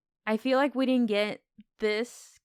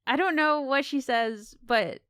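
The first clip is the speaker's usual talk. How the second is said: usual speaking voice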